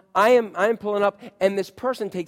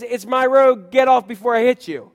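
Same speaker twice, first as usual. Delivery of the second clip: loud, higher road-rage outburst; indignant inner voice; 5 semitones higher, 8 dB louder than usual. Acted. Driver's inner voice to a jaywalker, loud and indignant. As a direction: punch out an indignant warning, loudly. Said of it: "something in me that says".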